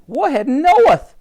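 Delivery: high, loud, drawn-out realisation; exclaimed. A narrator's reading. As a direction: announce the direction in astonishment. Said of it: "she exclaimed".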